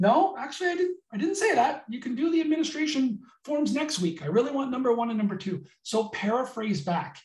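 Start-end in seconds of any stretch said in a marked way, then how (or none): 0.1-5.6 role-played prospect. Role-playing prospect correcting the salesperson.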